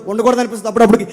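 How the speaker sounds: quick, rushed pulpit confession; fast preaching. Acted. Preacher confessing his urge to quit, fast preaching. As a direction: rattle off the frustrations, fast.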